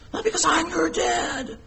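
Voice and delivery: high, strained parody of a bossy father; mock authoritarian dad. Shown as a demonstration. Father pulling rank on his children, mock authoritarian.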